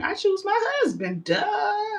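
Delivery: high-pitched, sassy brush-off; sassy retort. Retold or imitated. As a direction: toss it off like it's obvious, with a mocking duh. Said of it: "And she was like".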